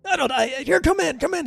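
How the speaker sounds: high, exasperated little guard voice; high scolding beet guard. Acted. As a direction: scold the two helpers in a squeaky voice. Guard ushering him in.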